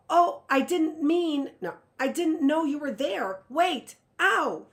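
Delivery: high, loud panic; desperate cry. A narrator's reading. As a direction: run after her shouting. Runs after witch, stumbles.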